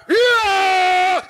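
yelled out of nowhere; a startled, sudden scream; mock scream in the theater; sudden shout. Lady suddenly shouts in movie.

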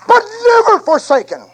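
high, loud preacher cry; preaching shout. Acted. Preacher crying out the promise to his flock, high and loud. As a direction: lift it to a ringing climax. Climax of the refrain.

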